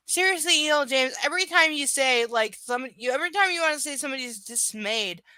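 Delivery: exasperated rant. Real, not acted. Ranting at the author.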